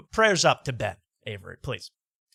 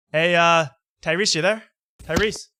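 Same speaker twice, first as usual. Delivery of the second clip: higher, louder call to a line that might be dead; 4 semitones higher, 5 dB louder than usual. Pretend. Host calls out to the guest on a pretend video call, raised voice. Calling out to fake caller.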